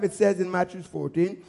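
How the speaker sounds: normal talking voice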